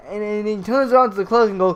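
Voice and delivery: ordinary talk, nothing marked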